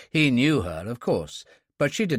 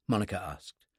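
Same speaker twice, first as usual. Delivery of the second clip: plain reading voice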